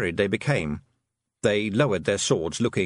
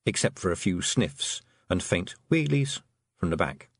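usual speaking voice

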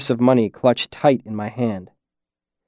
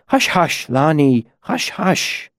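gentle hushing; 3 semitones higher, slower than usual. A narrator's reading. Trappist holding the rocking boy, gentle hushing. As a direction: hush him tenderly, almost whispering the last.

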